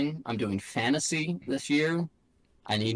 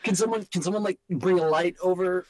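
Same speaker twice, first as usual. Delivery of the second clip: high, squeaky pretend crew member; playful high film-set complaint; high mock film crew; 8 semitones higher, 4 dB louder than usual. Acting out film set bit.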